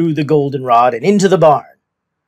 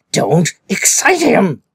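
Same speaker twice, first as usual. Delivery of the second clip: slow, higher pleading plea; imploring; 5 semitones higher, slower than usual. A narrator's reading. Min begging the children near the lion, imploring. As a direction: beg them to keep still. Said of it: "implored Min".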